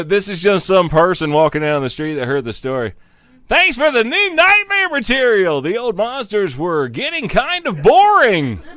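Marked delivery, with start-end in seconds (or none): 3.5-8.6 high, loud mock-excited commenter voice; hammed-up fan quote; reading a fan comment. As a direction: pitch it up like a giddy fan. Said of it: "the person who remarked".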